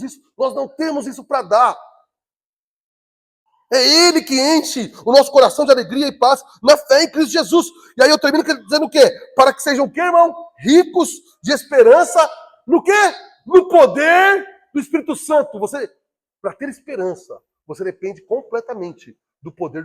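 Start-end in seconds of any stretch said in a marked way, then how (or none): 3.7-15.6 loud, emphatic preaching climax; pregação inflamada. Real, not acted. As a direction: build the call-and-answer at full force. (pregação forte, alta e enfática)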